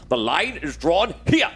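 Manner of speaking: Patrick Stewart. Acted. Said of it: "he will talk in the voice of Patrick Stewart".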